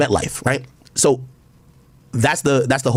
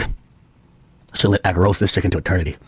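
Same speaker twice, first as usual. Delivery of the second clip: imagined [name]; 7 semitones lower than usual. Retold or imitated. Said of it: "[name] probably right now, like".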